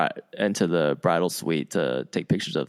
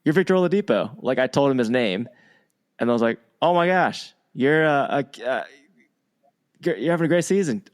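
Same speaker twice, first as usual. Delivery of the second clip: starstruck gushing; 6 semitones higher than usual. Retold or imitated. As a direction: blurt it out like a starstruck kid. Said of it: "I sounded like an eight-year-old myself".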